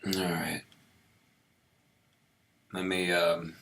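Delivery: hoarse whispered words; raspy whisper. Real, not acted. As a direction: voice almost gone, whispering. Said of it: "losing my voice here".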